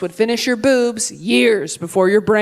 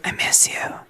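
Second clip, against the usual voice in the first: imitated secret whisper; soft, voiceless confession; whispered longing; 5 dB quieter than usual. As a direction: whisper it like a pining mom. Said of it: "every Christmas she's like".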